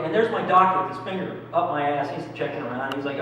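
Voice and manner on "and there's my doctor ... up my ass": usual speaking voice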